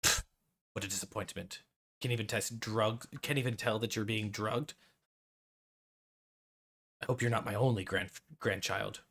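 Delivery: a hint of a scornful grandfather; disdainful grandfather. GM voices grandfather ghost.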